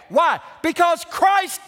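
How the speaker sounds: loud, high, exultant pulpit cry; full-force preaching. Real, not acted. Pastor exhorting Baptists over the risen Christ, full-force preaching.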